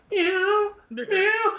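very high, crying meows; a frightened kitten calling out; cat meowing. Retold or imitated. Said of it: "that cat goes".

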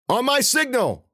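high, loud call to start; called signal. A narrator's reading. Loud call. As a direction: Rufus calling the signal to his friends. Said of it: "Rufus said".

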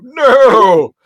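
high, loud yelp; yelled no. Retold or imitated. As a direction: scream it out in protest. Victim shouting refusal.

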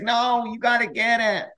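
hammed-up pleading, high; whiny roommate. Roommate Phil begging Chris to fix the blinds, mock whine. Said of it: "He's like".

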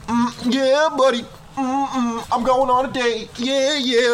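high, self-satisfied mock bragging; smug boy. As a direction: strut like a kid bragging about his date. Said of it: "be like".

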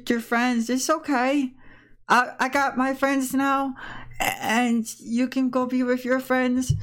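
put-on high, slow, halting young voice; earnest Riley letting go. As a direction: gently give your friend permission to leave.

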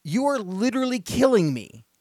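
loud, high, furious scolding; angry shout. Retold or imitated. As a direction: tell off the neighbor at the top of your voice. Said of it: "and I said".

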